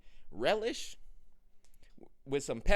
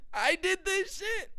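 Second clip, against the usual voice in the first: high, slow amazed; proud disbelief; slower than usual. Retold or imitated. Looking at his hands, slow, high, amazed. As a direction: marvel at your own hands. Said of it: "I'm like".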